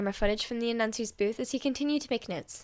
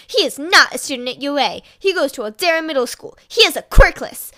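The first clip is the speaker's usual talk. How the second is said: dramatic email read; 3 semitones higher, 7 dB louder than usual. A narrator's reading. Reading a villainous email.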